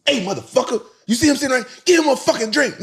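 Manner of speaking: manager yelling high and loud at a bartender, acted out; loud, high-pitched mock tirade; cussing someone out. Manager demands a drink for his guest from the bartender, cussing him out in a high shout. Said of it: "he cussed the dude out".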